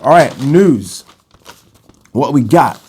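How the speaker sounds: slow, loud American announcer voice; a playful American accent, drawn out. Acted. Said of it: "Don't know why I did an American accent there".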